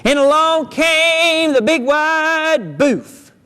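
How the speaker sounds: slow suspenseful narration; ominous storyteller. A narrator's reading. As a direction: stretch it out like a spooky tale.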